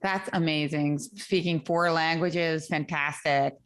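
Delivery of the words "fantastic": normal talking voice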